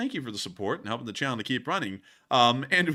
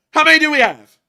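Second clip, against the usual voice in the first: high, loud demand for a count; very loud, raised-pitch call; 14 semitones higher, 16 dB louder than usual. Acted. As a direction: shout the question across to a co-host. Calls out to co-host for count.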